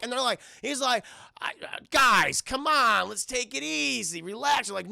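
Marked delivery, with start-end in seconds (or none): 1.4-4.7 placating. Retold or imitated. Mel calming the dealers.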